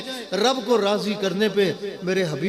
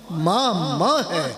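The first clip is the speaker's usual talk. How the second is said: slowed right down and drawn out, heartfelt; slow emotional echo; much slower than usual. Acted. Preacher repeats to the congregation, slow and heartfelt. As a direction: let it sink in slowly.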